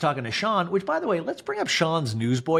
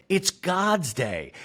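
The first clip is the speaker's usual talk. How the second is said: slow Portuguese mom; 3 semitones higher, much slower than usual. Retold or imitated. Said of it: "Linda in Portuguese goes".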